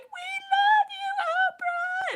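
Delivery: very high, loud, stretched-out shriek; drawn-out starstruck squeal; screaming fans. Retold or imitated. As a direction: scream it adoringly with the whole crowd. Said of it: "we were all just like".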